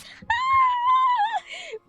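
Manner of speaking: high, loud burst of laughter; shrieking laugh. Real, not acted. Streamer recalling an old guy's accent, shrieking with laughter. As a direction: let out a squealing laugh.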